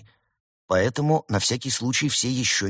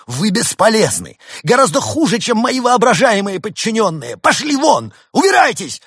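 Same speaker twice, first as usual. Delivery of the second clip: high, loud furious outburst; losing patience; 12 semitones higher, 9 dB louder than usual. A narrator's reading. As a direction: rage at useless underlings and throw them out. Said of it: "Крот не выдержал".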